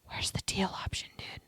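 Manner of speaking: whispered aside to herself; breathy whisper, hunting for an option. Real, not acted. Searching quiz answers, muttered aside.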